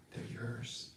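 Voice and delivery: soft breathy hush; whispered reveal. Acted. Shiva telling Parvati the skulls are hers, whispered. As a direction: let the secret slip out in a whisper.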